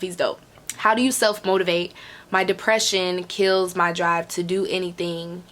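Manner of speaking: normal talking voice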